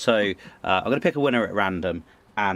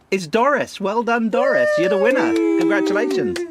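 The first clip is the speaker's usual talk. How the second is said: much higher and louder, shouting the winner's name with delight; excited winner shout; 12 semitones higher, 6 dB louder than usual. Real, not acted. Announcing the raffle winner to listeners, excited shout. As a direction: belt out the winner like a game-show host. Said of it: "it's all gone very loud in the green room there".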